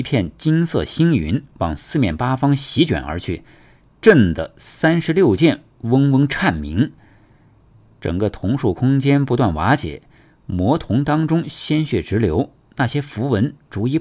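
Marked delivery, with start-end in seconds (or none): none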